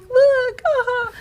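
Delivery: squealed high and loud, smug; mock triumphant. Wife mimics her old self to husband, triumphant squeal. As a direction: crow it like a proud little win.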